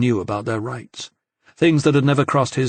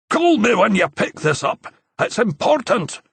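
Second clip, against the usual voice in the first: lightly voiced Scottish urgency; 10 semitones higher than usual. A narrator's reading. Simon's recorded message.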